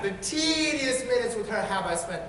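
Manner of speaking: usual speaking voice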